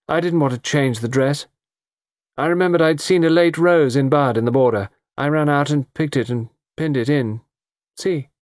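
chatty and a little too eager; young woman explaining the rose to Poirot; young woman's voice. A narrator's reading. Young woman's long answer.

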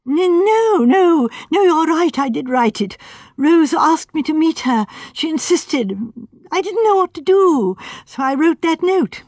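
flustered, insisting he was alone; nervous young architect. A narrator's reading. Sandford admits the note.

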